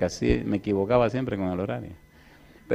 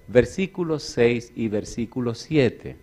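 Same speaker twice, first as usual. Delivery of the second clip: usual speaking voice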